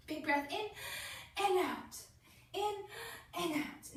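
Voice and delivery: put-on drawn-out breath cues for a child; gentle breathing coach. Shown as a demonstration. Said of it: "coaching child's breathing".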